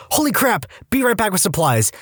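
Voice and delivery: high, alarmed exclamation, quickly reined in; startled nurse. Retold or imitated. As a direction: jolt up in shock then rush the rest. Nurse sees reopened incision.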